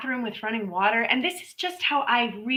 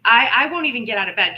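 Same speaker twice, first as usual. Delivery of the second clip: usual speaking voice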